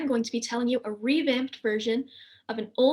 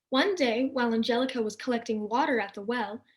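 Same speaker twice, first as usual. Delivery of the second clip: ordinary delivery, nothing marked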